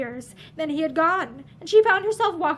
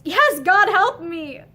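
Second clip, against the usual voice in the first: anguished cry; 4 semitones higher, 6 dB louder, much slower than usual. A narrator's reading. Lynn's anguished confession.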